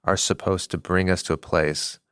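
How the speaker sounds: ordinary talk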